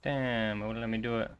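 ordinary delivery

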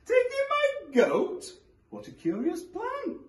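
put-on high, slow incredulous old woman; old lady voice. A narrator's reading. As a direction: drag it out like a shocked granny.

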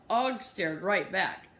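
plain reading voice

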